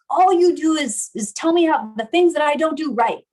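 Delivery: loud, high, exasperated complaint; heated accusation. Acted. Partner fighting back in an argument, accusing. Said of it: "in this really intense".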